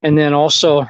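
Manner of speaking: usual speaking voice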